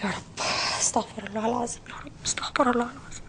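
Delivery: unvoiced, guilty whispering to God; a sister whispering her repentance after agreeing to help; whispered prayer. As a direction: mutter a quick prayer for forgiveness under your breath.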